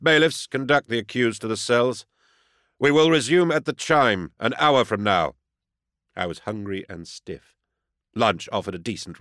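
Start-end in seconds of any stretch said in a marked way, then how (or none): 0.0-5.4 higher, projected courtroom command; formal proclamation. A narrator's reading. Inquisitor adjourning the hearing for lunch, formal proclamation. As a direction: give orders to the bailiffs. Judge orders bailiffs in court.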